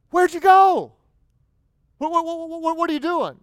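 high, loud baffled "where'd you go?"; 1 incredulous. Acted. Jesus calling after the nine who left, incredulous. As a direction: play it stunned and incredulous.

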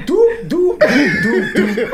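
bouncy "doop" steps, popping up high; playful mock footstep noises; cartoon tiptoe sound. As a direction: imitate sneaky little cartoon footsteps. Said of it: "He come to the car going".

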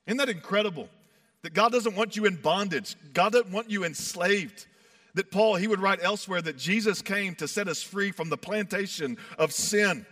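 normal talking voice

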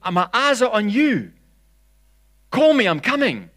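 high, loud, eager call. Acted. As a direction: shout it across the water. Peter calling over the waves.

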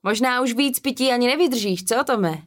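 taunting challenge. A narrator's reading. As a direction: goad him into climbing down. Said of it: "snažil se znít přesvědčivě".

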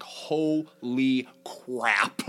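slow, drawn-out stunned reaction. Retold or imitated. Host retelling watching the rocket crash, slow and stunned. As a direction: stretch it out, jaw on the floor. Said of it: "because I'm like".

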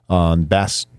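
ordinary talk

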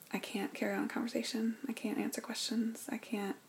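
a defeated, breathless whisper; hushed whisper. Retold or imitated. Wife recalling she couldn't talk at dinner, whispered. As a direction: whisper it, as if the words won't come.

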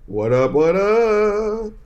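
slow, high call-and-response hello; hyped greeting. Real, not acted.

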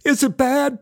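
high, urgent panicked question; panicked friend asking about his wound; screamed question. Retold or imitated. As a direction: ask it high and scared. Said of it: "Barry screamed".

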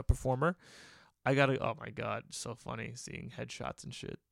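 usual speaking voice

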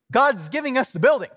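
triumphant announcement. Retold or imitated. Pastor answers doubting elders, triumphantly. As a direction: declare it like a retold victory.

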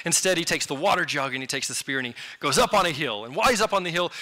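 ordinary talk

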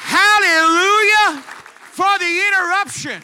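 very loud, high, drawn-out hallelujah; exultant preacher's shout. Real, not acted. Preacher to congregation at the story's climax, exultant shout. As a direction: belt it out in full praise.

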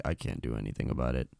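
very low, soft, resigned mock reply; helpless deep-voiced man. Him answering gushing fans, deep and helpless. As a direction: drop deep and quick, helpless. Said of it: "He's like".

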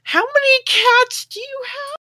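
high put-on voice. Player voices character asking.

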